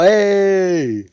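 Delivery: long, high, loud "hey"; playful call-out. Real, not acted. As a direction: holler a drawn-out hey. Playful call-out about himself.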